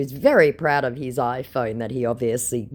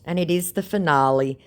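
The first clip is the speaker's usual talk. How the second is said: ordinary talk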